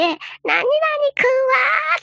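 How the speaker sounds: high, very slow drawn-out call across the snow; long-distance call. Retold or imitated. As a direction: stretch it out like calling a far-off friend. Calling to friend across snowfield.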